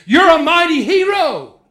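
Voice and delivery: high, loud proclaiming call; God's declaration. Retold or imitated. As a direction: declare it over him with force. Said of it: "God says".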